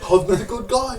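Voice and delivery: high, loud mock English accent; attempted English accent. Shown as a demonstration. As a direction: have a go at an English accent, sounding nearly Australian. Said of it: "I can't do an English accent".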